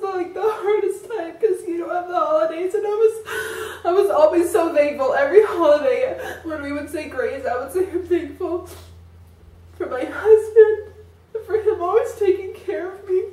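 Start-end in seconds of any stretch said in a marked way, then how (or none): 0.0-7.4 tearful laugh, voice high and cracking; crying laugh. Real, not acted. Crying about the holidays. 7.9-13.2 tearful quote. Retold or imitated. Recalls her grace, crying.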